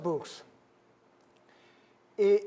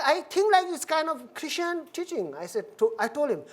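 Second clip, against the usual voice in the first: normal talking voice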